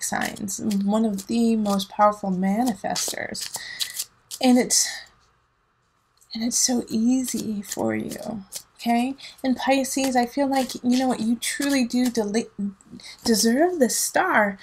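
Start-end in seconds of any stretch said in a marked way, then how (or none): none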